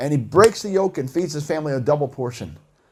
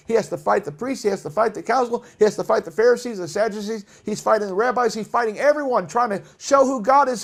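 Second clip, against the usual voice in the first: ordinary talk, nothing marked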